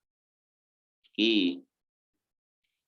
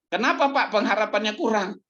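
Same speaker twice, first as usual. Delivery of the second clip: congregant's question; 7 semitones higher than usual. Acted.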